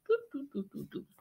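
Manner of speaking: very soft little marching doo-doos; marching sounds. Acted. Reader shows owl marching up and down, soft tooting. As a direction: toot a tiny march under your breath.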